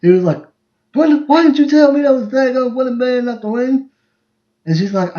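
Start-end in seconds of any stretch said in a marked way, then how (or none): 0.9-3.9 imitating an annoyed dad, higher and louder; mock exasperated complaint; dad mock-griping at mom about the ring, annoyed; ticked off. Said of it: "And Dad was ticked".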